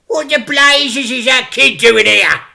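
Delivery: high, loud angry crook; shout. Acted. Crook Fingers spots a child by the car, a loud high angry shout. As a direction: bellow it at the getaway car. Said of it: "Fingers shouted".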